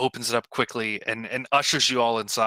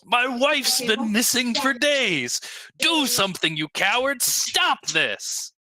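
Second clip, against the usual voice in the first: high, desperate yell at normal volume; 12 semitones higher than usual. A narrator's reading. Husband of a missing orc woman rallying the cowards, yelling. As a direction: plead angrily, pitched right up, dropping on the last words. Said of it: "a human man holds up a sketch ... and yells".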